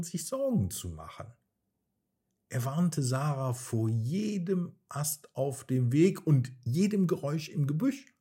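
usual speaking voice

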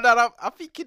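high and loud, worked up. Retold or imitated. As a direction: tell it all riled up. Retelling his reaction.

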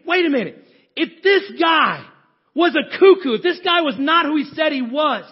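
loud, high, exclaiming; the penny drops, call it out; excited realization. Acted. Disciples' excited realization voiced.

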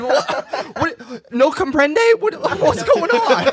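mocking playing dumb. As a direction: play the clueless guy, cracking up. Mocking the confused guy.

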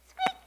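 tiny high squeak; nervous mouse squeak. A narrator's reading. Mouse hiding in its hole gives a nervous squeak. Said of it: "said the mouse nervously".

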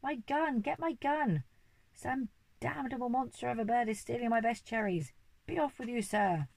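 the Duke yelling for his gun; furious shout. A narrator's reading. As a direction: roar at the thief in a rage. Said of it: "the Duke was shouting".

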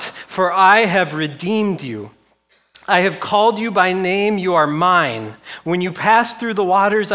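loud, high, emphatic Bible reading; Scripture declamation. Acted. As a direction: proclaim God's promise to the congregation. Reads Scripture aloud at force.

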